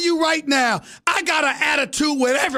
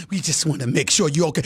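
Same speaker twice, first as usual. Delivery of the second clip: much lower, fast mock-sympathy; mock Golic; 13 semitones lower, faster than usual. Scene: mocking a colleague's check-in call, low fast mock concern. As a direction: mimic a fake-concerned caller way down low. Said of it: "it's that Golic calling me up".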